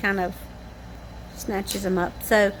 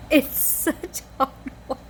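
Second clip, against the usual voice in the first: laughing; 3 semitones higher than usual. Real, not acted. Laughing about hoeing the garden.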